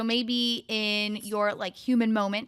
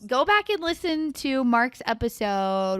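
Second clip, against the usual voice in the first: ordinary delivery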